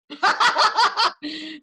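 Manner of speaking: short bright burst of laughter; amused laugh; real laugh. Real, not acted. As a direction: laugh at the rhyming names. Laughing at rhyming names.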